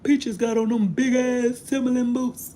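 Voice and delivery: high, slow, taunting cousin voice; loud mocking tease. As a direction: jeer at her like a teasing cousin. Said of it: "my cousin say".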